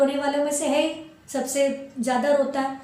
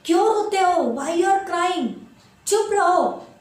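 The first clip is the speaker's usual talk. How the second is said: put-on louder, higher snapping at crying kids; irritated shout; 4 semitones higher, 3 dB louder, slower than usual. Irritated fairy shouts at crying children to hush. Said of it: "वो irritate हो गई".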